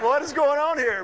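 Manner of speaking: playfully acted outburst; mock indignant demand. Imitates wife's startled reaction to him.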